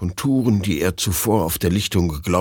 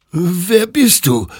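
high, slow, frightened whimper; 12 semitones higher, 5 dB louder, slower than usual. Acted. Hektor whimpers at the ghostly rider in fear. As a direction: whimper it out in terror. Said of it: "wimmerte Hektor".

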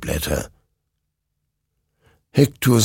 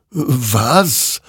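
wondering; 6 semitones higher, 3 dB louder than usual. A narrator's reading. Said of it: "wunderte sich dieser".

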